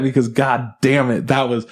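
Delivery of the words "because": ordinary delivery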